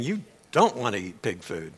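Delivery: ordinary talk, nothing marked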